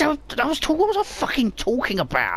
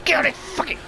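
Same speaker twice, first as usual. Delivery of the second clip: high, raised fighting shout; aggressive taunt; 9 semitones higher than usual. Real, not acted. Player threatening an enemy with the hook, shouting. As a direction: yell threats in the heat of combat.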